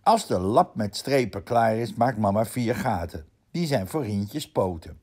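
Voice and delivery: plain reading voice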